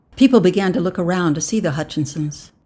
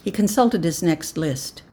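usual speaking voice

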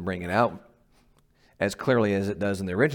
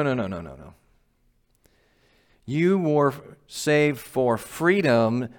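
ordinary talk

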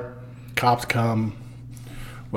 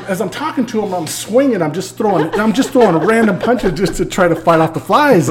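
ordinary delivery

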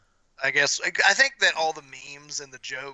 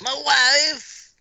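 Borat impression; 11 semitones higher, 5 dB louder than usual. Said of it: "You're just like".

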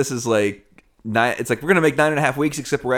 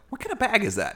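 mock baffled; 10 semitones higher, 6 dB quieter than usual. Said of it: "and I'm like".

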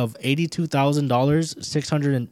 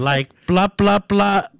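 mock blah blah; 7 semitones higher, 6 dB louder, slower than usual. Mocking story intro.

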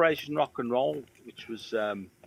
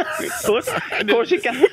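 words squeezed out through real laughter, pitched up high; laughing; 10 semitones higher, 4 dB louder than usual. Real, not acted. Retelling the hearing test joke to his friend, laughing. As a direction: laugh helplessly while repeating it.

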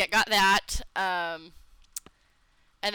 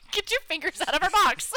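higher, scolding command; 8 semitones higher than usual. Real, not acted. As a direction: shoo them off sharply. Scolding an intruder.

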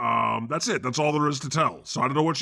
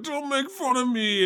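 put-on pitched way up, pleading like a hurt kid; high whiny plea; 12 semitones higher than usual. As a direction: whine it up high, begging not to be teased. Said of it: "'cause I was like".

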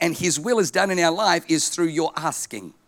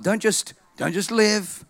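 ordinary delivery

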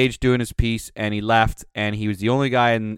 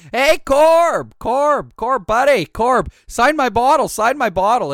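put-on drunk pleading yell; 13 semitones higher, 6 dB louder, slower than usual. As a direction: holler at him like a drunk fan begging for a signature. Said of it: "And I was like".

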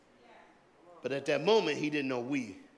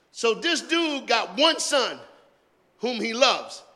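slow emphasis; 8 semitones higher, 7 dB louder, slower than usual. Acted. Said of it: "slow emphasized point".

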